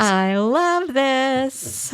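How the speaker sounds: drawn out, high and delighted. Real, not acted. Cohost delighted by the library news, drawn out high. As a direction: savour every word with delight.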